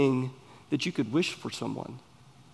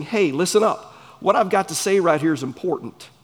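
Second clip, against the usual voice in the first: raised, louder acted announcement; emphatic call for attention; 4 semitones higher, 5 dB louder than usual. Preacher voices a speaker telling hearers to listen, emphatic attention call. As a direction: demand their ears for something important.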